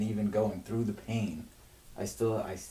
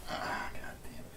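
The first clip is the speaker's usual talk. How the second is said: whispered groan of despair; 8 dB quieter than usual. Real, not acted. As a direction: let it slip out, spent. Exhausted despair alone.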